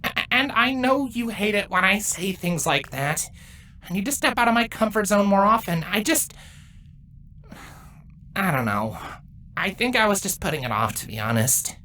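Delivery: low, halting admission; nervous excuses. Acted. Explaining himself to an older broodmate, nervous and halting.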